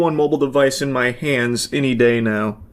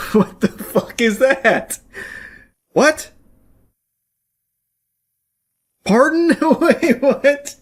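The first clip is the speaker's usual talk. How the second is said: high, stunned disbelief; baffled disbelief; 11 semitones higher, much slower than usual. Real, not acted. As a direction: sound utterly thrown by the hang-up. Baffled after the call drops.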